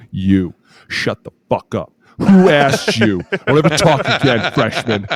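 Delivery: slow, drawn-out put-down rising to a loud high jab; scolding senior. Retold or imitated. Senior teammate shuts up the freshman, mock-angry scolding. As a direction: imitate a fed-up senior silencing a rookie. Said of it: "Nick sits up and goes".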